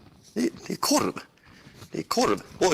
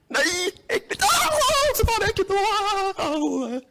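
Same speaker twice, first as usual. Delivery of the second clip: high squeal of disgust; a real squeal of disgust; 12 semitones higher, much slower than usual. Real, not acted. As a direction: shriek it out in revulsion.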